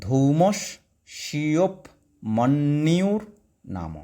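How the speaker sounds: ধৌমস্যোপমন্যুর stretched out with a rising end; very slow broken reading. Shown as a demonstration. Teacher slowly repeating the broken-up ধৌমস্যোপমন্যুর. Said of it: "ভেঙে দিয়েছি".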